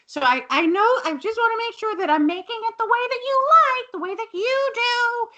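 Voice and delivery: very high, fast, anxious voice; nervous high gush; eager Mrs Garrett. Retold or imitated. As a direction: rush it out high and eager to please. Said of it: "she's like".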